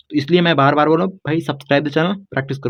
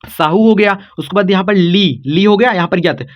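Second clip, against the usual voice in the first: normal talking voice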